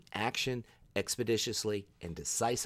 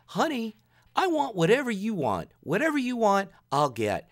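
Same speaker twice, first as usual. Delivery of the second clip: high, louder mock-sappy boyfriend; doting boyfriend; 11 semitones higher, 6 dB louder than usual. Mimicking the boyfriend deferring to his date, sugary high voice. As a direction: gush like a smitten boyfriend, pitched high.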